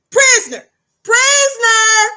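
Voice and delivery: high, loud calls, the second slow and drawn out; repeated commanding call. Shown as a demonstration. Guard calling the inmate to order, loud and drawn out. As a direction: repeat the word like an order, stretching the last one. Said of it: "That's how I refer to it".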